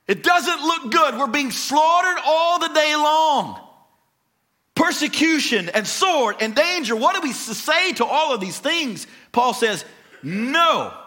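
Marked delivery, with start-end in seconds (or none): 0.1-9.1 high, loud-edged cry of despair; acted despair. Preacher voices Paul's suffering to the church, despairing. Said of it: "You hear the despair in Paul's voice". 10.2-11.0 "No" raised in pitch and punched out as one big word; emphatic, louder NO. Acted. Preacher voices Paul answering despair, emphatic "No". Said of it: "I was a little bit louder when I said the word no".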